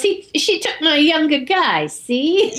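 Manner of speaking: high put-on Cockney voice; mock Cockney. Woman explaining her grudge, mock Cockney. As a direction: whine it in hammed-up Cockney. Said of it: "It was a Cockney accent".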